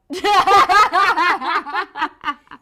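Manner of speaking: big burst of loud laughter. Real, not acted. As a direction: crack up loudly.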